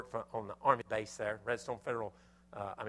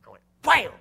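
mock wham; 10 semitones higher, 18 dB louder than usual. Hammering in frustration.

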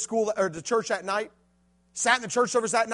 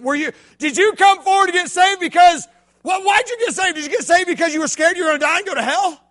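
high, loud preacher's cry; preaching at full force; 11 semitones higher, 12 dB louder than usual. Acted. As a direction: lift it to the back row.

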